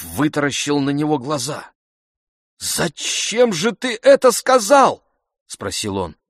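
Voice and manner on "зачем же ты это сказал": high, loud, drawn-out indignant question; appalled outburst. A narrator's reading. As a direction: demand an explanation from the servant, voice shooting up. Master appalled, questions servant.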